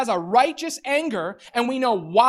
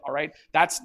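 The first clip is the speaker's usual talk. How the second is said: ordinary delivery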